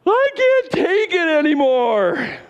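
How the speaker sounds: high, slow, whining wail; long drawn-out whiny moan; wailing Israelites.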